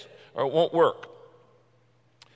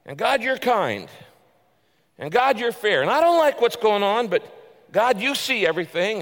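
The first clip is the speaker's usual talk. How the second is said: building preaching; 5 semitones higher, 4 dB louder than usual. Real, not acted.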